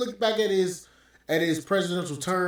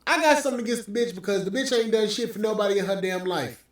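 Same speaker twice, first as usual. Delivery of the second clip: ordinary delivery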